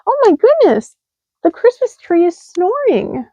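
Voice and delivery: very high shocked cry; surprised cry. A narrator's reading. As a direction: gasp at the tree. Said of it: "Mouse cried".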